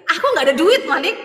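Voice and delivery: tertawa sambil mengeluh tidak punya uang, nada naik dan lebih keras; tertawa getir. Real, not acted. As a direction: ketawakan kesulitan sendiri. Laughing about having no money. (curhat sambil tertawa kecil)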